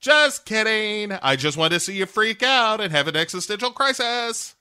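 high put-on teasing cat voice; Grumpy Cat. Cat teasing its panicked owner, mock gleeful. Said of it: "Grumpy Cat is just like".

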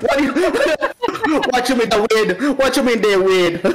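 joking Jamaican patois, breaking into laughter; Jamaican accent. Retold or imitated. New kid questioning classmates in school, mock Jamaican accent. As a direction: imitate a Jamaican, cracking up. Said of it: "they thought I was Jamaican".

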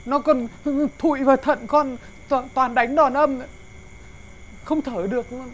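loud, aggrieved grumbling; loud complaint. Acted. Son-in-law loudly complaining to father-in-law about his wife's beatings. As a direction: blurt out the grievance loudly.

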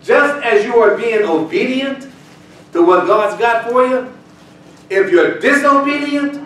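loud preaching, slowing on key words. Real, not acted. Pastor urging the congregation, loud and emphatic. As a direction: drive it home to the church.

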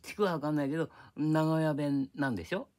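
usual speaking voice

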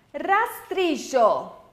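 "Rastrillo" bem devagar, sílaba por sílaba; slow model word. Shown as a demonstration. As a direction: alongue "Rastrillo" como modelo. ("Rastrillo" lento e articulado, para repetição)

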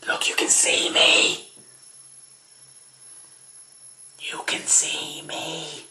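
slow excited whisper. Acted. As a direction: breathe it secretly, slowly.

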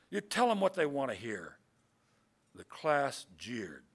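measured, projected poem recital; poetry reading. A narrator's reading. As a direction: read the poem out to a room, pausing between phrases. Reads a poem aloud to audience.

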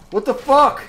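stunned gamer outburst; shocked yell. Real, not acted. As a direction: blurt it out in disbelief. Sudden shock in game.

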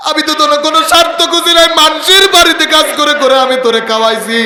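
high, loud and fast, a preacher voicing a wronged mother; pleading mother. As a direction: cry out the mother's hurt to her son.

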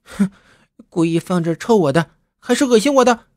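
put-on girl fuming over the smelly shoe; huffy shout. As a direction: huff and shout in indignation. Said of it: "马娟气呼呼的叫".

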